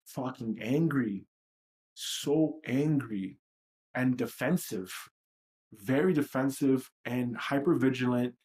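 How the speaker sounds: ordinary delivery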